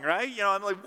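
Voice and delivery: ordinary talk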